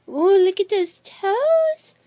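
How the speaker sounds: high, slow, drawn-out coo. Real, not acted. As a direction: gush over a pet's tiny feet. Cooing over cat's toes.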